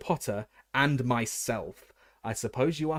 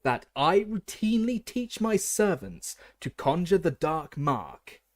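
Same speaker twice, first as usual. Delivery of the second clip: cold anger; 8 semitones higher, 5 dB louder, slower than usual. A narrator's reading. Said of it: "cold anger in every syllable".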